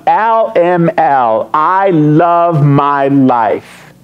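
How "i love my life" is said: I LOVE MY LIFE, each word stretched slowly; slow, savoured declaration; drawn-out declaration. Acted. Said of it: "I've come up with one".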